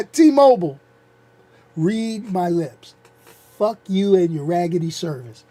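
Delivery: T-Mobile called out loud, then read my lips spelled out slow; slow emphatic putdown. Real, not acted. Vlogger telling off T-Mobile, slow and emphatic. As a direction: spell it out slow so they get it. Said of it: "Let me say it like this".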